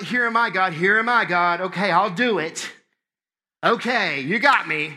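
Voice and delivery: loud, drawn-out grudging surrender; mock reluctant consent. Shown as a demonstration. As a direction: give in noisily, as if talked into it. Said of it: "and not".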